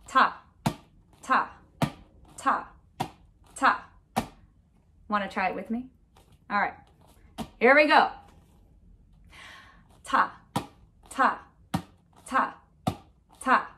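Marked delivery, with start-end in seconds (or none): 0.1-3.9 one syllable per beat, evenly spaced; steady beat. Shown as a demonstration. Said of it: "keep that steady beat". 10.0-13.7 one syllable per beat, evenly spaced; steady beat. Shown as a demonstration. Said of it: "keep that steady beat".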